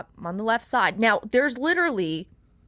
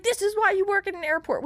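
high-pitched retold jab; cutting comeback; 11 semitones higher than usual. Traveler replaying her mean jab at the lady, heated. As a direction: hurl the insult way up high. Acting out screaming match.

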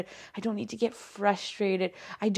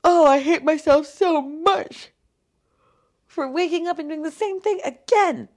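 loud, high, mock self-loathing wail; mocking her old self-hate; 10 semitones higher, 6 dB louder than usual. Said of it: "In the past, I would have been".

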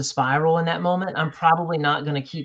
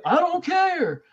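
much higher put-on voice of a sulky kid; defiant son; 4 dB louder, much slower than usual. Dad imitating his upset son brushing him off, high sulky kid voice. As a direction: toss it off like a pouting teen. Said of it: "and he's go".

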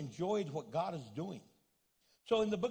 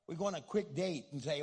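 ordinary delivery

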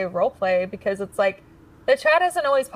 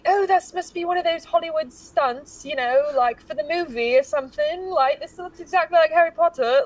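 high-pitched London accent, hammed up; put-on London accent, lifted high; improvised British accent; 7 semitones higher than usual. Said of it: "your British accent just insulted".

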